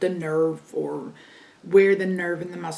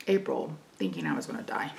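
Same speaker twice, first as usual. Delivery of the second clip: whisper; 11 semitones lower, 6 dB quieter, faster than usual. Retold or imitated. Dramatic fear of dying.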